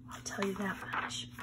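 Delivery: conspiratorial whisper. Real, not acted. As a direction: lean in and whisper the hint. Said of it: "hints shared secretly".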